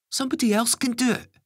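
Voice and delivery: put-on high fast young boy. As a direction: rush it out like a kid.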